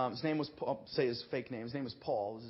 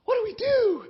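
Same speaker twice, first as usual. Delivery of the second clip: high, loud, helpless cry; panicked question; 12 dB louder than usual. Retold or imitated. Young pastor asking in a panic what to do. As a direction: beg for an answer.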